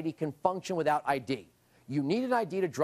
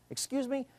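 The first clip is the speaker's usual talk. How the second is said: higher-pitched incredulous query; mock disbelief; 8 semitones higher, 4 dB quieter than usual. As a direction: feign being taken aback. Mock disbelief at quoted claim.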